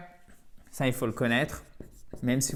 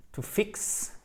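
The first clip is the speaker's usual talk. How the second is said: "to fix" whispered; whispered slip; 6 semitones higher, 7 dB quieter than usual. Real, not acted. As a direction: breathe "to fix" without voice. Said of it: "sorry guys".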